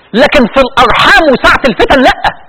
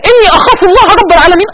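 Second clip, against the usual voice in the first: tearful recitation; 5 semitones higher than usual. Real, not acted. Preacher moved to tears reciting the verse. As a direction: voice breaking on the verse.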